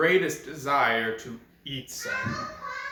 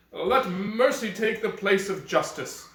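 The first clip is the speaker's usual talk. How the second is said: frightened pleading; 7 semitones higher, 4 dB louder than usual. Acted. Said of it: "he was terribly afraid".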